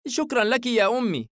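put-on high child's voice, smiling; happy young boy. A narrator's reading. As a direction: thank mother like an eager little son. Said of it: "ابتسم محدقا بوالدته".

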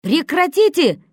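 loud high slow yell; frantic scream. A narrator's reading. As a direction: scream to stop them. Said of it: "истошно заорал Трошкин". (истошно орёт)

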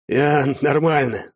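wheezing through pain; hoarse wheeze. A narrator's reading. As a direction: gasp it out clutching the chest. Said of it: "захрипел отец, схватившись за грудь".

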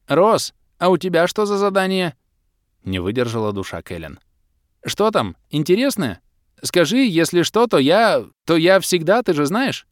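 high, loud eager chatter; eager Kelen. A narrator's reading. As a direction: pester him excitedly. (Кэлен нетерпеливо и громко выспрашивает)

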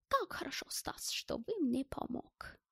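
high, hushed German character; admiring. A narrator's reading. German praises Stas for helping, admiring soft voice. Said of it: "Все восхищался моим поступком Гельмут".

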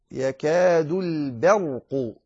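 slow, thin B in البرق; light ba. Shown as a demonstration. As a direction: keep ب of البرق light before heavy ر. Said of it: "لأن الراء مفخمة".

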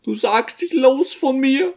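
put-on a fretting little old woman doting on her son; old mother's voice. A narrator's reading. Old mother fussing over her son to the guests, high-pitched.

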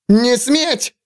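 high shrill shriek of "Не сметь!"; взвизгнул в панике. A narrator's reading. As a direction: squeal it in panic, gun in hand. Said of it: "взвизгнул юноша".

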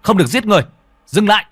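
loud commanding shout. Acted. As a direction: yell to stop the killing. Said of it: "quát lên". (quát lớn)

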